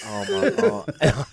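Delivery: slow, laughing groan of embarrassment; slow laughing disbelief; laughing oh my God. Real, not acted. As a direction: laugh through it, cringing. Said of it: "And I was like".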